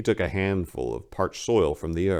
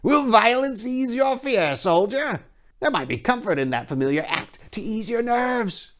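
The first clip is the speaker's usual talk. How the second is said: high, oily priest voice; a leering, smug high priest; sly high priest; 4 dB louder than usual. Acted. High priest placating the king's men, high and oily. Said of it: "leering smile engulfed his face".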